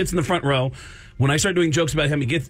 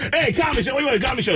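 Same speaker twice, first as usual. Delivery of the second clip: higher, calling-out barker voice; street barker; 9 semitones higher, much faster than usual. Retold or imitated. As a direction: hawk the show to people walking by. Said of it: "a barker out front going".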